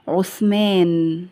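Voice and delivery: very slow, stretched word; drawn-out dictation. Shown as a demonstration. Teacher listing tricky example words, drawn out. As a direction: hold the long final vowel.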